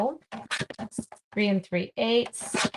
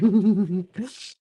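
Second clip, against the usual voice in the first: laugh; 5 dB louder than usual. Real, not acted. Laughing at solar filter joke.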